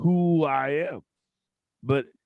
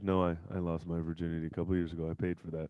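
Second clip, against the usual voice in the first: calm low voice; 8 semitones lower, 8 dB quieter, faster than usual. Retold or imitated. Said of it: "He's like".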